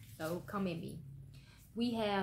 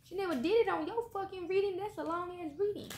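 exasperated outburst; 9 semitones higher than usual. Real, not acted. Exasperated outburst about reading length.

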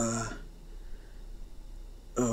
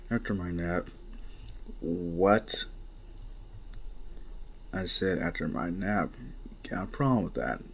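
lazy boss voice; 5 semitones lower, 7 dB quieter than usual. Acted. Gang boss brushing them off.

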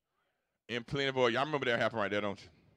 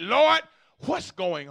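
anguished cry to God; 11 semitones higher, 12 dB louder, much slower than usual. Acted. Crying out to God in prayer.

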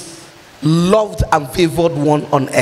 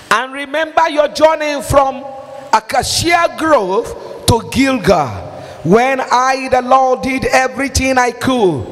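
scripture reading; 4 semitones higher than usual. Acted. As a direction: boom the verse out like God himself speaking. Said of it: "He said".

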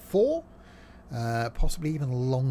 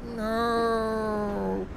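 retold dismay; 8 semitones higher than usual. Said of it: "It's like".

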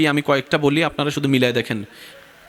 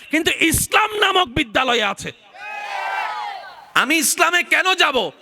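fiery preaching; 13 semitones higher than usual. Acted. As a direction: preach it at full force, rising in pitch. Preacher's climactic rhetorical questions. (উচ্চস্বরে জোরালো ওয়াজ)

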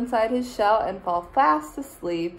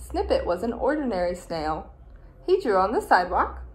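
usual speaking voice

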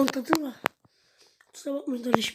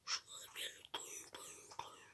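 whisper; 27 dB quieter than usual. Real, not acted. Placing the lamb, very soft.

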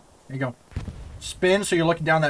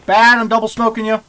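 shouting; 6 semitones higher, 7 dB louder than usual. Real, not acted. Calling to teammate in battle.

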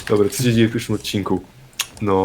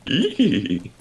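Scooby-Doo voice; 6 semitones higher than usual. Pretend. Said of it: "czy to brzmiało chociaż trochę jak Scooby".

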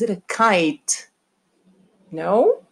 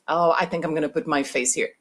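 normal talking voice

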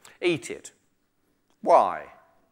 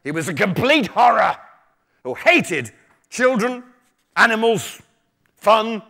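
dramatic story reading; 5 dB louder than usual. A narrator's reading. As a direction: relish each phrase, pausing between items. Reads a book taster aloud dramatically.

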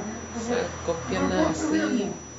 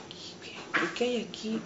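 hushed, stammering self-question; bewildered murmur; 9 dB quieter than usual. Retold or imitated. Survivor lost in her own town, murmuring in retold bewilderment. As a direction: murmur it in disbelief, thinking aloud. Reliving being lost among the ruins.

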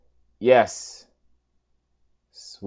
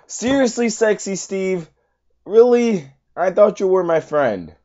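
mock betrayal; 9 semitones higher, 6 dB louder than usual. Real, not acted. Betrayed by player Sexy Steve.

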